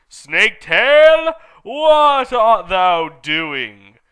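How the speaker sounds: slow, loud, drawn-out villain call; Voldemort calling out. Acted. Voldemort summoning Snaketail from afar, slow loud eerie call. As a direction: call out slowly, commanding and eerie. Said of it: "called Voldemort".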